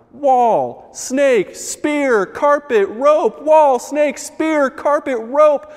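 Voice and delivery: high, loud shouted clash of words; shouting match. Acted. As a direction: yell each word over the others. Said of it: "their shouts grew louder and louder".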